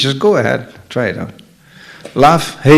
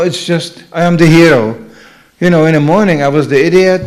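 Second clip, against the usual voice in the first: ordinary talk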